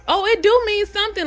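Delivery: high, fast, sassy mock comeback; a hammed-up mother with attitude; imitating her attitude. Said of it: "she got a whole attitude".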